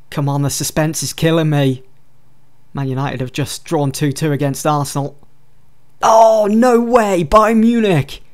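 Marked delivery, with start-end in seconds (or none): 6.0-8.2 high, loud, slow disbelief; surprised. Real, not acted. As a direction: react like bad news just landed. Reacting to cup draw result.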